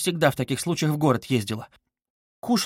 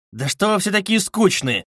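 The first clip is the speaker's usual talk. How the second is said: loud, high outburst; unexpected raised-voice complaint; sudden exclamation; 8 semitones higher, 5 dB louder than usual. A narrator's reading. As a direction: cry out abruptly at everyone. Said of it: "вскрикнул он вдруг совсем неожиданно".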